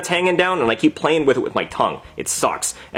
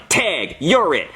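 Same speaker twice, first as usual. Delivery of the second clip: slow, deliberate payback delivery; retaliatory taunt; 3 semitones higher, much slower than usual. Retold or imitated. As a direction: land each word slowly in your own register. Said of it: "and I'm like".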